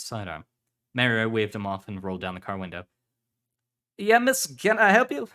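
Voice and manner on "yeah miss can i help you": much higher, loud officer's question; officer hailing a passer-by. Acted. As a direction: call out a helpful question, loud and high. Officer voiced to a stranger.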